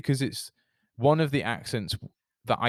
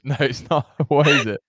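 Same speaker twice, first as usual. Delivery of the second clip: usual speaking voice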